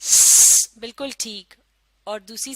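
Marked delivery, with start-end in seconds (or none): 0.0-0.8 loud voiceless hiss for स; s sound demo. Shown as a demonstration. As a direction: hiss स hard. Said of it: "पहली sound क्या थी?".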